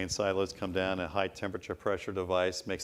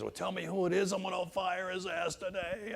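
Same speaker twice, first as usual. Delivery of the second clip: angry CEO; 10 semitones higher than usual. Retold or imitated. Furious CEO demands name.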